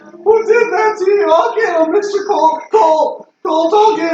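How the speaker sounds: high, loud, frantic yelling; frantic high shout. Acted. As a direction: yell it in high panic.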